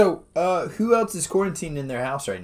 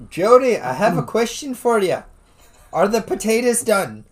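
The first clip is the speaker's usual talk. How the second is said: higher, louder Irish mother; Irish accent; 7 semitones higher, 5 dB louder than usual. Pretend. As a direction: nag about potatoes like an Irish mum. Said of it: "See, that's an Irish".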